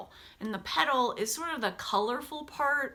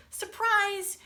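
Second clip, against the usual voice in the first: higher, bright Surprise!; excited reveal; 11 semitones higher, 3 dB louder than usual. Acted. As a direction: pop the reveal for the class. Repeated reveal in read-aloud.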